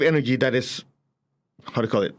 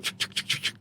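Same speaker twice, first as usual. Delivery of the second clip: voiceless rapid ch sounds, very soft; whispered ch clicks; 10 dB quieter than usual. Retold or imitated. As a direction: mimic the eye-tracking rhythm. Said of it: "You go like".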